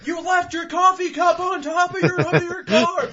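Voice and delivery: very high, loud yell; hammed-up helpful shout; shouted heads-up. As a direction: holler it at a passing driver. Imagined helpful stranger shouting.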